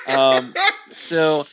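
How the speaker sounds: normal talking voice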